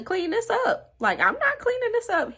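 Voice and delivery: high loud indignant refusal; indignant outburst. Retold or imitated. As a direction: put your foot down loudly. Angry refusal.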